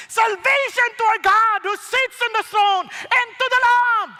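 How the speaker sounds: high, full-voiced shout of praise; loud ringing cry, stretched at the end; crying out loudly. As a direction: proclaim it like a roaring multitude. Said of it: "they cry out with a loud voice".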